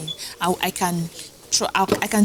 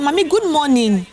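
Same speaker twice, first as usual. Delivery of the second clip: higher-pitched insistent morning greeting; repeated greeting; 7 semitones higher, 4 dB louder than usual. Retold or imitated. Schoolgirl greets unanswering landlady, high insistent greeting. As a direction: greet her so she has to answer. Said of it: "she did not answer".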